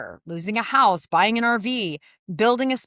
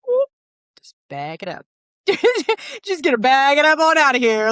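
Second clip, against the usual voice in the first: mock awkward onlooker; 3 semitones higher than usual. Retold onlooker backing away.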